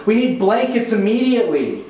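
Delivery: high, urgent and fussy; a panicky wife demanding blankets now; paranoid housewife. Acted. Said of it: "The paranoid housewife".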